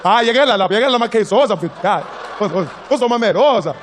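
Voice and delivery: loud, high beckoning call; calling over. Acted. Sergeant calling colleague over to hear the story, loud. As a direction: wave him over with your voice.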